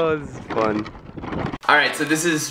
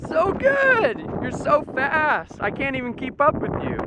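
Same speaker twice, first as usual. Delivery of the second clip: cheering on; 14 semitones higher than usual. Real, not acted. Uncle cheering nephew on his new bike, high. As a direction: cheer him on as he rides.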